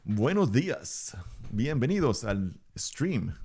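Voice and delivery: slow, sing-song Spanish welcome; slow Spanish greeting. Pretend. Welcoming the stream viewers with a slow sing-song Spanish greeting. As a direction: stretch out a cheerful Spanish welcome. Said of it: "speak multiple languages very badly".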